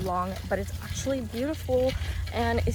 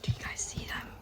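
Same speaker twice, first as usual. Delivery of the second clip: whispering; 11 dB quieter than usual. Real, not acted. Friends whispering while others are walking nearby, hushed. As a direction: keep it hushed and voiceless.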